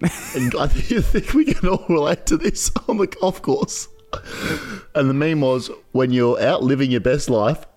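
trying to read through the giggles; high-pitched, barely holding in laughter; cracking up while telling it; laughing. Real, not acted. Cracking up reading out a meme.

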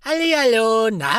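high, bright hello; cheerful jester greeting. Pretend. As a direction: greet like a merry court jester. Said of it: "dann komm ich da rein und sag". (fröhlicher Hofnarr)

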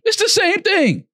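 high, drawn-out disbelieving cry; incredulous protest. Real, not acted. Co-host arguing over fake pictures, incredulous protest.

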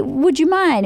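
considerate, courteous request in a lifted voice; respectful request. Shown as a demonstration. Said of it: "be respectful and mindful".

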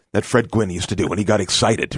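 usual speaking voice

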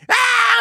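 loud, very high "aah!"; mock panicked scream. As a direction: burst into a hammed-up scream of alarm. Acts out officers suddenly panicking.

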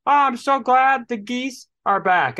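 high, sweet mock delight; mocking a woman thrilled the geese are back, pitched high; cheerful woman. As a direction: imitate a gushing neighbor woman. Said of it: "she's like".

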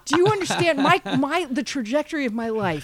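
high, loud rueful outburst; escalating comedy rant. Real, not acted. Comic rant about missed chance.

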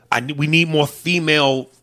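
ordinary talk, nothing marked

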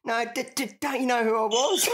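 comic squeaky stammer of the injured jockey; imitated stuttering protest. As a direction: ham up the stuck, indignant plea. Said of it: "you said".

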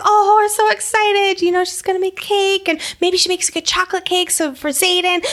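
high, gushing squeal of delight; squeaky fake enthusiasm; mock excited gushing. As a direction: gush like a starstruck fan. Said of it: "it's like".

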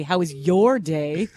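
"your" stretched out slowly and leaned on; slow down and hit "your" pointedly, making it accusing; stress on your. Shown as a demonstration. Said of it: "The emphasis on the wrong word".

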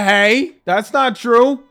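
ordinary talk, nothing marked

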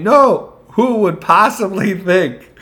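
high-pitched, feigned disbelief; mock denial. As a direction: play the company scoffing at the very idea. Voices company's mock denial.

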